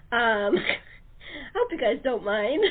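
laughing through it, a bit embarrassed; half-laughing apology, slow; sheepish laugh. Real, not acted. Writer laughing as she admits casting herself.

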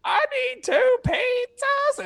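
high, slow fake woman's voice on the phone; disguised caller voice; prank caller voice. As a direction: put on a high lady voice to prank. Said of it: "I'd be like".

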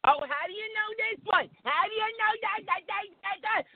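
high-pitched, put-on nagging voice; mock nagging wife. Imitating a wife's endless questions, high nagging.

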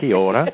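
"Kia ora" in the Māori greeting, Kiwi style; playful New Zealand "Kia ora". Shown as a demonstration. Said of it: "we got you talking Kiwi".

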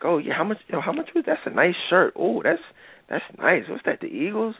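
high, sing-song imitation of a flirting woman; flirty woman. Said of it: "she's like".

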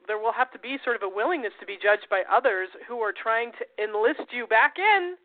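ordinary talk

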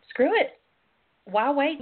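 normal talking voice

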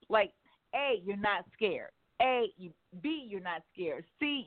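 ordinary talk, nothing marked

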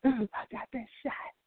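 high, slow whimpering admission; whiny mock confession. Man whines to his girl about the shot, high and slow. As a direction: whine it out like a sorry boyfriend. Crying man explains, laughing.

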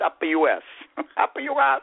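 laughing taunt. Retold or imitated. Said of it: "I said".

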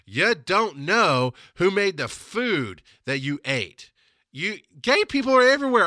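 loud, high, furious outburst retold; angry rant. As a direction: blast her with indignant fury. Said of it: "I lost my shit too".

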